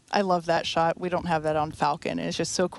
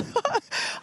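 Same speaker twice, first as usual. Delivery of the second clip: high breathy laugh of relief; giddy laugh. Real, not acted. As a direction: laugh out the tension. Reaction after launch.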